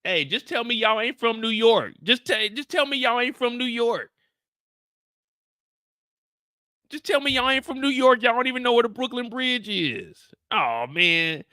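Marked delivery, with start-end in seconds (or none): 0.0-10.2 high-pitched exasperated complaint. Real, not acted. Fed-up streamer confronting viewers about New York, real exasperation. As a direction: whine it high, pressing them to admit it. Frustrated with viewers' wrong directions.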